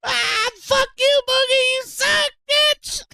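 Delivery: high, slow mocking squeal; high taunting jeer. Real, not acted. Streamer taunting Boogie on the clip with a high jeer.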